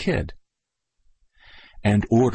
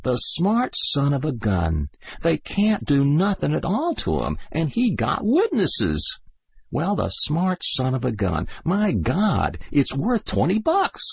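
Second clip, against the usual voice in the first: put-on higher, amazed kid soldier; admiring young soldier; 7 semitones higher than usual. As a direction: marvel at his friend's trick. Kid's admiring voice.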